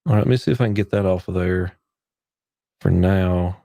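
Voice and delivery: usual speaking voice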